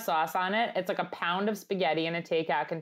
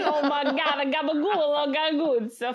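high, loud Long Island Italian accent; 8 semitones higher, 4 dB louder than usual. Shown as a demonstration. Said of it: "I moved into my Long Island accent".

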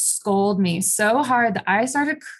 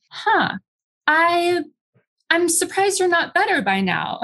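pitched-up, fake-surprised condescension; mock surprised surgeon; 9 semitones higher than usual. Surgeon feigning surprise at his struggling patient, mocking high voice. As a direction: mimic the dismissive surgeon, high and sing-song. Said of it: "he's like".

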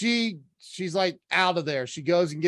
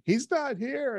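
higher-pitched imitation of a crying caller; mock tearful; 9 semitones higher than usual. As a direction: lightly imitate a tearful, panicked report. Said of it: "she calls me crying".

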